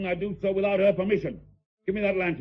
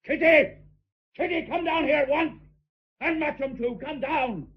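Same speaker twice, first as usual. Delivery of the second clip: calling out; 8 semitones higher than usual. Acted. Uncle shouts upstairs for Kitty to come down, calling out. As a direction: bellow for her to come down.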